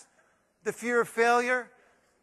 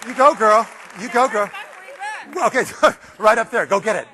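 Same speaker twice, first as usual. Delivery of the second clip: loud cheering; 3 semitones higher, 7 dB louder than usual. Acted. Cheering on a note-taking audience member.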